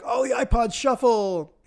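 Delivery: high-pitched imitation of an excited crowd; mock excitement. Everyone greeting the iPod Shuffle, mock excited. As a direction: gush in a hammed-up high voice. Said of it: "how excited everybody was".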